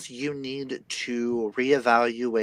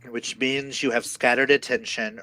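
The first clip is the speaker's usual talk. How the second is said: ordinary delivery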